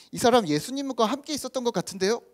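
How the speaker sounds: lightly acted maid's suspicion; lightly voiced servant girl. Voices servant girl pointing out Peter.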